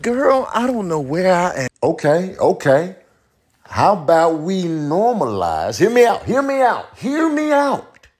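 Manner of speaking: very high, squeaky-pitched pleading; high-voiced comic character. Asking to be heard out, high character voice. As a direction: push the voice far up and plead.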